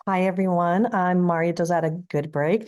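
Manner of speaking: ordinary talk, nothing marked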